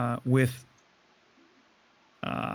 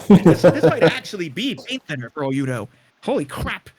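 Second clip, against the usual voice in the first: louder, laughing through the words; 2 semitones higher, 7 dB louder than usual. Real, not acted. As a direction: crack up at the joke. GM laughs at paint thinner.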